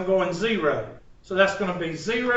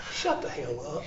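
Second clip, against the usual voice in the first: mild mock retort; 7 semitones lower, 12 dB quieter than usual. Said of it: "I looked at her like".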